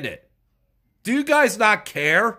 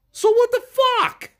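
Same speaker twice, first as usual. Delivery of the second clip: exasperated outburst; 12 semitones higher, faster than usual. Real, not acted. Outburst in a rant.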